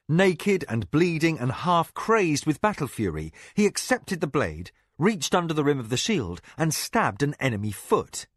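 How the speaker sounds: plain reading voice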